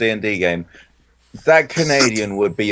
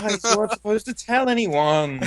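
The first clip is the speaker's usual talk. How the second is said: high, softer British mock warning; Terry; 9 semitones higher, 5 dB quieter than usual. Briton takes over the imagined line, mock British warning. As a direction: imitate a worried Brit in a high voice. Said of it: "That's Terry".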